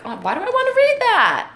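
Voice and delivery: high, loud protesting question; exaggerated put-off objection; mock reluctance. Acts a reluctant reader's reaction.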